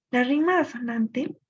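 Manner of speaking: ordinary talk, nothing marked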